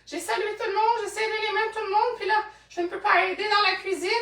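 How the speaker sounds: high, hurried excuse-making voice; high-pitched mock protest; acted excuse. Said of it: "On est comme".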